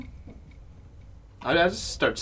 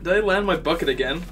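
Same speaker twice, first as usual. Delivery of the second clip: dazed loud; 4 dB louder than usual. Acted. Dazed character wakes confused.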